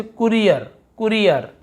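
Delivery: "कुरियर" with a short उ in the first syllable; short u in courier. Shown as a demonstration. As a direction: clip the first vowel of "कुरियर". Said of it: "छोटा ऊ की मात्रा कुरियर ऐसे है". ("कुरियर" में छोटा उ)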